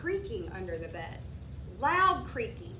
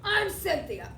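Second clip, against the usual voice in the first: put-on louder, offended girl monster; snappish; 4 semitones higher, 4 dB louder than usual. A narrator's reading. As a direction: challenge him, annoyed. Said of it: "she snapped".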